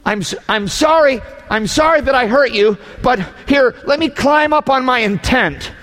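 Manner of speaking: high, self-righteous whine; mock noble apology. Shown as a demonstration. Preacher hamming up a self-righteous apologiser, high and pompous. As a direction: climb onto your noble intent, pompous and hammed-up. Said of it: "pathetic apology".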